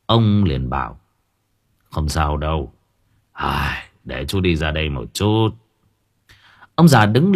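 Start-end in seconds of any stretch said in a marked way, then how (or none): 1.9-5.5 put-on low, soft old worker; gentle reassurance. A narrator's reading. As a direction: soothe him quietly in a low voice. Old worker reassures injured Chín. (trấn an, giọng trầm nhẹ)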